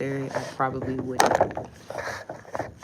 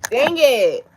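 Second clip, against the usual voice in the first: loud, higher burst of frustration; frustrated outburst; 10 semitones higher, 15 dB louder than usual. Real, not acted. Frustrated outburst at dropped chicken, loud. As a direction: blurt it out in real exasperation. Chicken fell, phone won't charge.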